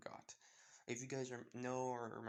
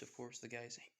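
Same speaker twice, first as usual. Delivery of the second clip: ordinary delivery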